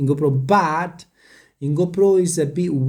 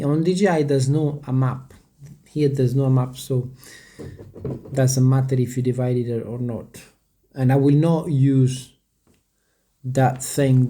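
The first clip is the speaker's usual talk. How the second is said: ordinary talk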